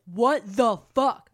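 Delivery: loud, drawn-out disbelief, each word punched; imitated outrage. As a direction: act out her exasperation, loud and slow. Retold outraged reaction of character.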